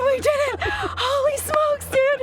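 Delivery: high, loud, breathless celebration; elated. Real, not acted. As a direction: shout out the win in disbelief. Celebrating the downed turkey.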